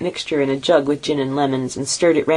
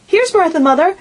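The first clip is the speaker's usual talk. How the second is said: put-on much higher, louder eager children; excited children's cry; 14 semitones higher, 5 dB louder than usual. A narrator's reading. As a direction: shout the news like little kids. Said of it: "cried the two young Cratchits".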